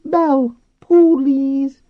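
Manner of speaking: very high, loud dolphin squeal; talking dolphin. A narrator's reading. Dolphin Flo pleading.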